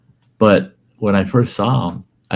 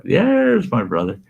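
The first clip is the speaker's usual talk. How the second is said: higher, happy call across the store; excited greeting; 10 semitones higher than usual. Retold or imitated. Greeting his brother across the store, excited. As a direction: call out to him gladly.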